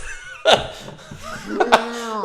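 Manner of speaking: high laughing burst; helpless laugh at the ending; real laughter. Real, not acted. Hearing the code word twist, a real burst of laughter.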